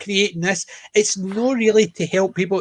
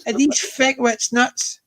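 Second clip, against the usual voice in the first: higher, scornful rhetorical question; exasperated disbelief; 7 semitones higher than usual. Retold or imitated. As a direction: scoff at how daft they are. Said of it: "you're sitting there thinking".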